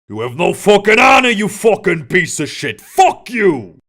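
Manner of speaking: orc bellowing curses; furious shouting. A narrator's reading. Orc Matzo screaming insults at Kalabasi. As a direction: spit every insult with fury. Orc keeps cursing captor.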